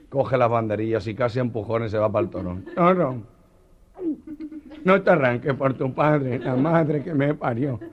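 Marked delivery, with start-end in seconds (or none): 2.7-7.8 higher, slow pleading to the bull; terrified plea. Retold or imitated. The Meloja pleading with the bull, slow and scared. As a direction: beg the bull not to charge.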